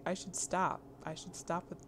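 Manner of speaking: ordinary talk, nothing marked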